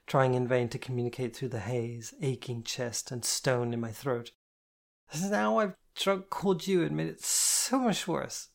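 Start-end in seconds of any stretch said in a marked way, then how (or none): 5.2-8.5 put-on upset drunk. A narrator's reading.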